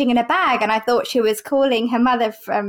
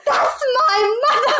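much higher, slow, laughing shout; shocked exclamation; 11 semitones higher than usual. Retold or imitated. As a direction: blurt it out half laughing. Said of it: "she went".

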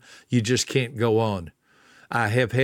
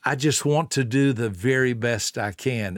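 ordinary talk

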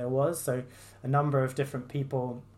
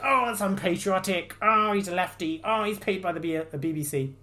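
higher sneering mock voice; mocking critics; 6 semitones higher, 4 dB louder than usual. Vlogger mimicking Lineker's critics, sneering mock voice. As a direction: parody the outraged critics. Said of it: "people were like".

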